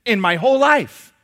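high, loud, eager gushing; a fan who can't stop raving; excited gushing. Retold or imitated. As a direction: burst in with praise. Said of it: "He said".

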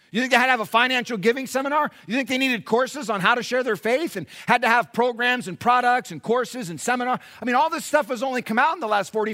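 forceful preaching. Real, not acted. Preacher challenging church about the early believers' giving, forceful. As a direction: challenge the congregation with insistent questions.

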